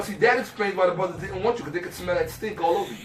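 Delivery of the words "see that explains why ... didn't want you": ordinary delivery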